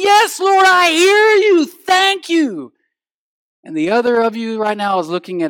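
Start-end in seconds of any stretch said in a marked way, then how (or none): 0.0-2.7 high, loud cry of praise; fervent shout. Acted. Eager listener answering the Lord in church, fervent shout. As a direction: shout it to heaven like an eager worshipper.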